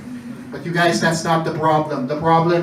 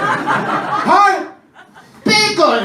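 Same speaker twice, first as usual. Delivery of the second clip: battle cry; 11 semitones higher, much slower than usual. Acted. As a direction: shout each name like an attack. Bacon attacking heart.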